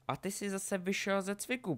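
high-pitched duelling jibe; taunting. Acted. Draco mocks Harry mid-duel, taunting.

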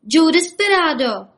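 high, loud cry of the name; shouting in ear. A narrator's reading. Rabbits shouting into the sleepy sheriff's ear, high and loud. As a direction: yell it together like excited little rabbits. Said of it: "křičeli mu do ucha".

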